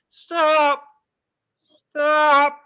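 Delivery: high, loud panicked begging; terrified plea to get off; panicked yelling. Retold or imitated. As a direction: scream at the ride operator to stop. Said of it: "I was just like".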